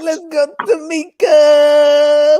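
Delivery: high, loud, slow cheer. Real, not acted. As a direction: shout her on from the side. Cheering on the comic.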